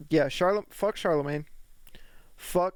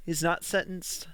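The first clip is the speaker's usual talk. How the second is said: ordinary talk, nothing marked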